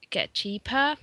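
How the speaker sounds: usual speaking voice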